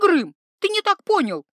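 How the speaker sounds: high, loud, hurried pleading; urgent plea. Acted. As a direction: grab his arm and beg him to listen. Iva pleading with angry troll.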